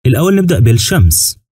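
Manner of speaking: ordinary talk, nothing marked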